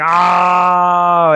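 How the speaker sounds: loud, high drawn-out "oh"; shocked outcry. Retold or imitated. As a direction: re-enact a big scandalized cry. Retells own shocked reaction.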